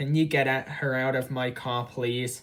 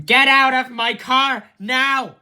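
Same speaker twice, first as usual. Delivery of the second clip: screamed high and loud, all at once; real furious shouting at a passenger; furious yell; 12 semitones higher, 10 dB louder than usual. Real, not acted. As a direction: yell him out of the car in real rage. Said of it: "Why are you yelling at me?".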